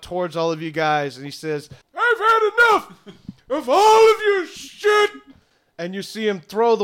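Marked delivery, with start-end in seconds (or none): 2.0-5.1 high, loud furious yell; angry wizard. Acted. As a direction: bellow it like a fed-up old wizard. GM voices angry wizard Crandon.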